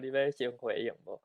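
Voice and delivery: ordinary delivery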